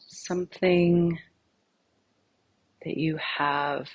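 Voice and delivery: soft, unhurried meditation voice with long pauses; guided meditation. Acted. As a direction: gently lead them inward, phrase by phrase, leaving silence between. Leads a guided meditation exercise.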